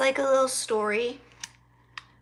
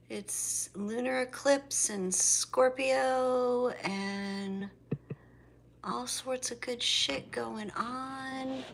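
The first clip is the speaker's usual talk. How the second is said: slow hushed intro voice; greeting viewers in a slow half-whisper; soft breathy hush; 6 dB quieter, slower than usual. Real, not acted. Hushed greeting to viewers, soft and slow. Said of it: "I don't know why I'm talking like that".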